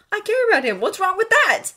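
high, loud, defensive anger; heated retort. A narrator's reading. Draco defending caring about Harry, angry. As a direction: defend yourself hotly. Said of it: "Draco hissed".

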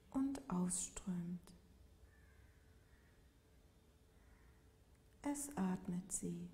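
calm yoga nidra voice, long unhurried pauses; guided meditation. Real, not acted. Yoga teacher guiding listeners lying in Shavasana, calm meditative delivery. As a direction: lead a body scan gently, leaving space after each phrase.

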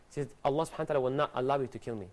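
ordinary talk, nothing marked

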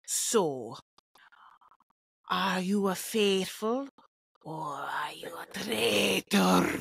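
slow, low Scottish accent with overdone rolled R, dropping to a whisper on "traitor"; Alan impression. Shown as a demonstration. Podcaster attempting the host's Scottish accent, too much R in "traitor". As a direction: overdo the R in "traitor", almost Spanish. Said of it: "A little too much R".

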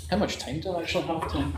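quiet aside. Real, not acted. Speaker quietly asks chair Tim how much time is left, hushed aside. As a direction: drop out of the lecture and ask the chair under your breath.

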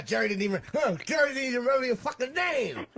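high-pitched mocking imitation of a rival; Colin's jeering. Comic retells Colin taunting him about Jerry, high mock jeer. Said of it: "he goes, uh".

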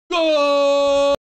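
long loud held GOL; goal shout. Real, not acted. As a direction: belt out the goal.

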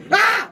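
sudden loud "ah!"; short, high startled cry; startled yell. Real, not acted. Player jumped by Chica, startled yell.

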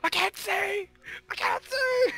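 high, loud cries of alarm; escalating high-pitched yell; panicked shouting. Pretend. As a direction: shout it as if suddenly blinded. Player blinded after explosion.

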